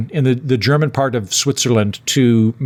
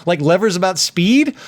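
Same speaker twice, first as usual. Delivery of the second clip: higher, halting, mock-baffled protest; incredulous questioning; 6 semitones higher than usual. Passenger questioning how a train takes a wrong turn, incredulous. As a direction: reason it out in comic disbelief. Retold disbelief at the conductor's excuse.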